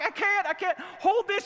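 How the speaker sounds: high-pitched frantic daughter; imitated panic. Daughter to dad with spraying hose, mock panic. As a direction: mimic a flustered kid, voice way up.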